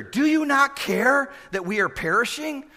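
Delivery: high, loud, desperate cry; frightened disciples yelling at their sleeping teacher; panicked yell. Acted. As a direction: yell it as the storm hits. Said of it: "And so they're yelling".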